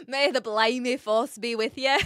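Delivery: cheeky mock Scottish accent; hammed-up Scots sign-off. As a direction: say it as an amateur Scot. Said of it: "my best attempt at a Scottish accent".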